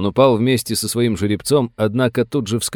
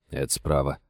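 usual speaking voice

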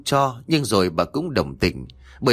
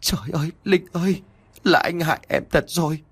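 higher, anguished crying cry; 7 semitones higher than usual. A narrator's reading. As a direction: wail it out with guilt and grief. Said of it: "Nam òa lên khóc". (khóc òa, giọng cao và đau đớn)

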